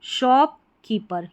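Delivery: Shopkeeper said slowly and fully; slow model word. Shown as a demonstration. Teacher sounding out shopkeeper for beginners, slow. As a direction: draw out shopkeeper syllable by syllable. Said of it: "इसको shopkeeper पढ़ा जाता है".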